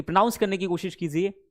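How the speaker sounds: usual speaking voice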